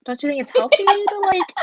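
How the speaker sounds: high, loud, incredulous challenge; pointed question. Retold or imitated. She presses her date on feelings, high and loud. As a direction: push back on him, half disbelieving.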